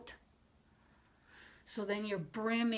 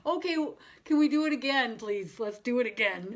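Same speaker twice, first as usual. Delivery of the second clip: eager pleading; 2 semitones higher, 7 dB louder than usual. Acted. Student asking the guide for a redo, loud mock pleading. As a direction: act out a student begging for another go. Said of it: "And you'll be like".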